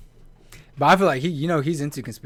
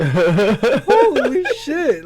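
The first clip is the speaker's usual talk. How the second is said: drawn-out, high, loud exclamation; slow, laughing cry of shock; laughing disbelief; 11 semitones higher, 6 dB louder, much slower than usual. Real, not acted. Laughing at own story.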